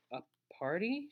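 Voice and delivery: high, tentative question; nervous surprise. A narrator's reading. Nervous girl gets an unexpected party invitation, timid surprise. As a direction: ask it as if unsure it is meant for you. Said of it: "She looked around all nervous and such".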